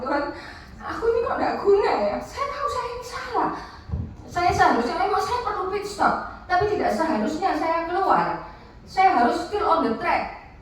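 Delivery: high-pitched, plaintive re-enacted prayer; retold tearful plea. Woman confesses to God she feels useless, retold tearful plea. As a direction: plead helplessly with God, as if near tears. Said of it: "menangis, saya, apa, saya ngomong".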